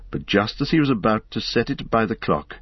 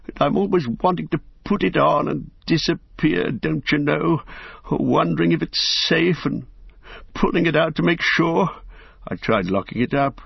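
put-on higher, fretful old hobbit voice; worried and unsettled, pitched up; anxious old hobbit; 7 semitones higher than usual. Bilbo voiced by narrator.

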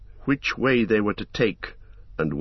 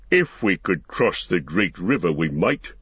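orc voice; 4 semitones lower than usual. A narrator's reading. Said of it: "said another voice".

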